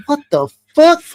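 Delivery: high, loud retold double take; shocked disbelief. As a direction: cry out in disbelief at the sign. Spots the church sign.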